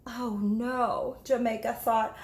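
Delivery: plain reading voice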